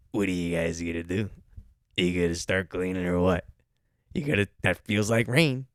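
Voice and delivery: low mock snob ordering staff; entitled manager. Same entitled manager imitation repeated.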